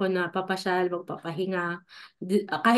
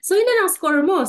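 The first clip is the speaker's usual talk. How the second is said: high, louder mother asking; mother's voice; 9 semitones higher, 5 dB louder than usual. Retold or imitated. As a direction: mimic mom's pitched-up question. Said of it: "sabi niya sa akin". (boses ng nanay na mataas)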